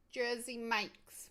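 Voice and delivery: put-on slow, drawn-out Jersey Mike's in a mixed British and New York accent. Streamer to chat about her sandwich, slow mock British-New York accent. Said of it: "mixing my British accent and my New York accent".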